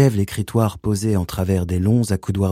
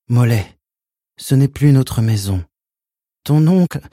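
higher, louder pleading mother; 5 semitones higher, 4 dB louder than usual. Acted. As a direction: beg her to understand. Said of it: "Sa voix était implorante".

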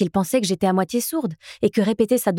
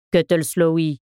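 low growled address; growling thug; 4 semitones lower, slower than usual. A narrator's reading. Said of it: "grogna-t-il".

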